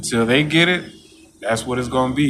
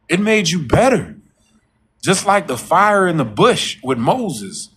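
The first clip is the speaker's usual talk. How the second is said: preaching; 6 semitones higher than usual. Acted. Sermon-like story of fiery furnace.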